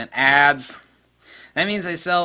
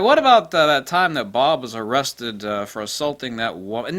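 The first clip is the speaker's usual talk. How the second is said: mock challenge; 7 dB louder than usual. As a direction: jump in loudly, accusing. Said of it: "It's like".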